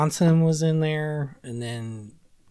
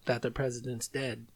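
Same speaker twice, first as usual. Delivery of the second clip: quiet lyric recitation; 5 dB quieter than usual. Retold or imitated. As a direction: murmur the lines like a dark poem.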